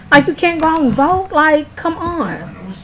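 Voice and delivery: can't believe anyone skipped voting; incredulous. Real, not acted. Incredulous rant at non-voters.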